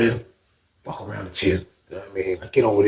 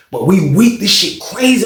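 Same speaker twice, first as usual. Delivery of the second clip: ordinary talk, nothing marked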